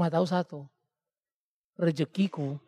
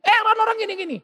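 high, loud agitated "eh, orang-orang gini"; mock fussing wife; 5 dB louder, faster than usual. Wife getting worked up about people, high loud mock agitation. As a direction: fuss and complain, pitched up. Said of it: "pada waktu dia yang ribut-ribut".

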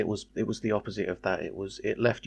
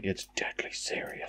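whispered deadpan mock of Ben; breathy flat mock seriousness; breathy deadpan impression; 3 semitones lower, 9 dB quieter than usual. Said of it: "I can't imagine you going".

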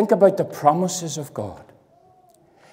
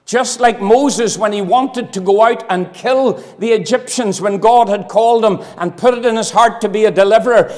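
booming revivalist preacher; preaching at full force; 10 semitones higher, 9 dB louder than usual. Acted. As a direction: drive it home from the pulpit. Preacher's emphatic build on Moses.

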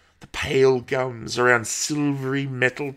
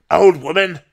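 high, loud, affronted echo; indignant snap; 4 semitones higher, 7 dB louder than usual. A narrator's reading. Old woman throws Paul's insult back, indignant snap. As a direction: snap it back as an offended question. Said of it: "she snapped".

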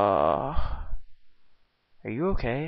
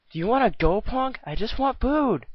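angry threat; 8 semitones higher than usual. Acted. Angry customer picks a fight with the clerk, high threat. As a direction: square up to the clerk.

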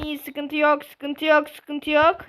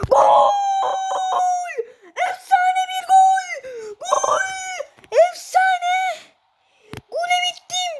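screaming football commentator; slower than usual. Real, not acted. Goal celebration shouting.

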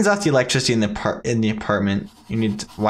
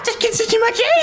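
high squeaky elf voice; christmas elf. Shown as a demonstration. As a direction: pitch way up like a tiny elf. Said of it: "That doesn't sound like an elf voice".

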